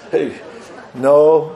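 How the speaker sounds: usual speaking voice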